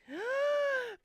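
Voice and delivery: sharp high gasp of surprise; startled, high-pitched gasp; sudden gasp. Real, not acted. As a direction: gasp as if you've just spotted someone. Suddenly spots Pickles on stream.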